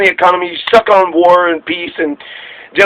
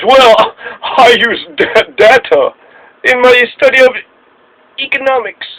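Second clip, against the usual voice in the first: pretentious voice; 6 semitones higher than usual. Retold or imitated. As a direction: lord it over everyone like a stuffy scholar. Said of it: "that pretentious".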